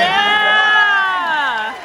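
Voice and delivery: loud, high cheer; cheering shout. Real, not acted. Audience member cheering the show, loud and high. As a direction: yell it out for the performers.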